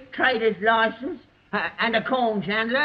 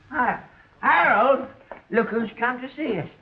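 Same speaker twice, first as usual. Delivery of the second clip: ordinary delivery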